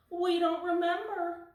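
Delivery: two worried little kittens pipe up; kittens' voices. Acted. Kittens answering their angry mother, high and loud.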